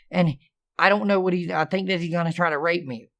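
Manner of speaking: usual speaking voice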